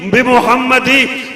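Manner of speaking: loud, ringing sermon recitation; preaching at full force. Acted. Preacher reciting Arabic praise verses to the gathering, full-voiced. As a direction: declaim the verses to the crowd.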